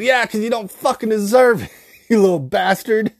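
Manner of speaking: high, loud grandma retort broken by laughter; cranky old lady. Retold or imitated. Grandma answering her grandson's complaint, cranky old lady voice. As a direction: imitate a cussing grandma while cracking up. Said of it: "she said".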